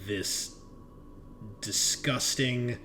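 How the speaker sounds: ordinary talk, nothing marked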